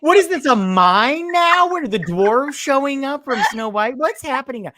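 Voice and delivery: high, loud mock disbelief; incredulous. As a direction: squeal it in baffled disbelief. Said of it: "I was like".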